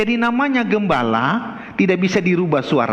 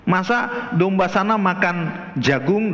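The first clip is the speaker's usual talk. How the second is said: normal talking voice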